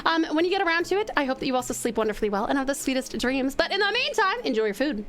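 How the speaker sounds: quick rattled-off thanks; fast. Real, not acted. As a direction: rush through the thanks. Rapid thanks to viewer.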